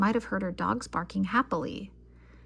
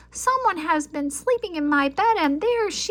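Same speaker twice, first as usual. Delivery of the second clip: much higher, louder squeaky cub; squeak; 9 semitones higher, 7 dB louder than usual. A narrator's reading. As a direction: squeak it out, excited.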